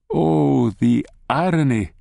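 usual speaking voice

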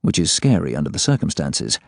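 usual speaking voice

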